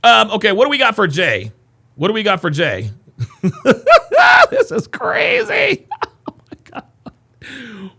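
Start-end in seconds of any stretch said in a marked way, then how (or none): 3.4-7.9 high, slow words through laughter; laughing helplessly. Real, not acted. Streamer laughing at chat's suggestions, helpless laughter. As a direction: squeeze it out while laughing.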